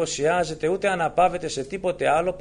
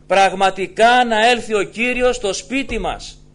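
loud, insistent sermon voice; fervent preaching; 6 semitones higher, 6 dB louder than usual. Acted. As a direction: drive the promise home to the faithful. Preaching at full force. (ένθερμο, δυνατό κήρυγμα)

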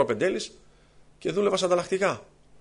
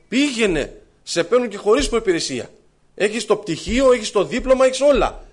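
louder, higher, pushing a friend to go; a brother louder on the phone, insisting he apply; insistent urging; 5 semitones higher, 7 dB louder than usual. Retold or imitated. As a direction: press him hard to apply. Και επέμενε.

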